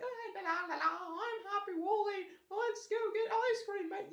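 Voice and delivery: very high, loud sing-song silly voice; happy Wally. Shown as a demonstration. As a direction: squeal it like a hammed-up, overjoyed cartoon character. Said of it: "hear the difference in my voice".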